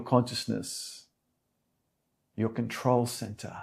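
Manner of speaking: calm meditation guide, unhurried with long pauses; soothing yoga teacher leading a meditation; guided meditation. Real, not acted. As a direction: settle them gently into stillness, phrase by phrase.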